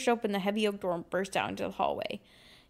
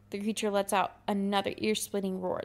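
ordinary delivery

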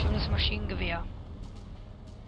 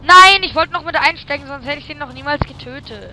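loud, high frustrated yell; 7 semitones higher, 14 dB louder than usual. Real, not acted. Frustrated gamer outburst.

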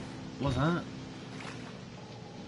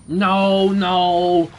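scared no; 3 semitones higher, 9 dB louder than usual. Real, not acted. Frightened refusal.

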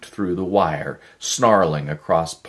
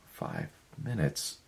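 much softer, falling echo of five minutes; stunned echo; 11 dB quieter than usual. A narrator's reading. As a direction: let "Five minutes?" drop quietly in disbelief. Miller echoes her words.

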